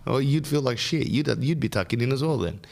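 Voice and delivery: ordinary talk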